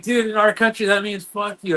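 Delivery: high-pitched imitation of an offended friend; friend's indignant explanation. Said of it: "He's like".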